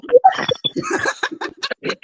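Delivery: shrieking burst of laughter up high; high squealing laugh. Real, not acted. Friend laughs hard retelling her story. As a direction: let a high laugh fly out.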